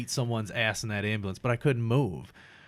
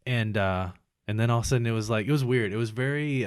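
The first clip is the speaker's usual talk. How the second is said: ordinary talk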